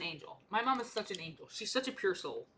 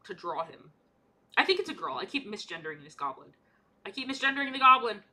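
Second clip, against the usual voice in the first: ordinary talk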